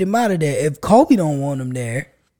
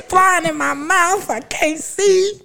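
mock distressed lady; 12 semitones higher, slower than usual. Mimicking the fallen lady.

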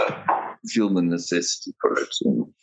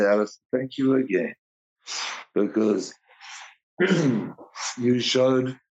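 choked up; 5 semitones higher than usual. Real, not acted. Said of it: "Sorry, I'm choking up".

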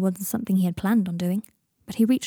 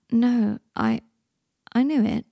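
halting and hesitant, a little higher; slowly; 3 semitones higher, slower than usual. A narrator's reading. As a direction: stumble into it slowly, with pauses. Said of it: "said Harry slowly".

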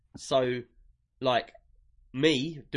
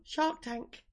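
high, softer mock American accent; 10 semitones higher, 7 dB quieter than usual. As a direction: mimic the American pronunciation in a squeak. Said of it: "your version sounds so crap".

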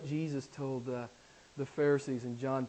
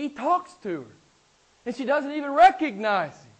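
high, loud, emphatic sermon; full-force preaching; 9 semitones higher, 8 dB louder than usual. Real, not acted. Preacher arguing why Mary believes, full-force preaching. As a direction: argue it hard from the pulpit, pitch up.

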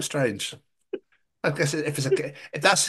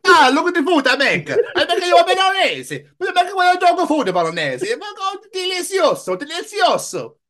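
much higher, louder hammed-up Italian; mock Italian dog; 5 dB louder than usual. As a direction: boast about the food like an Italian chef. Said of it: "Italian dogs just wanna eat".

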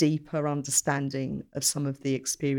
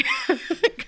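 short high laugh; embarrassed laugh. Real, not acted. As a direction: laugh off the praise. Embarrassed by praise.

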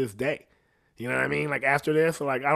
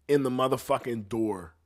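ordinary talk, nothing marked

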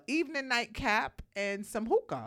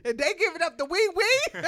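talking through laughter, higher; laughing; 9 semitones higher, 8 dB louder than usual. Real, not acted. Host laughing at her own joke with her cohost. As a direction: get the words out while cracking up.

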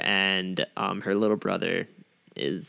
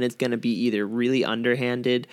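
normal talking voice

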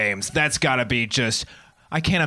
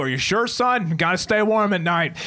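concerned dad; 7 semitones higher than usual. Acted. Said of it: "Dad pops up too".

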